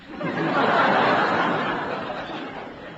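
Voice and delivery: a high, open burst of laughter; hearty laugh. Acted. Husband laughing with his wife over their dozen children.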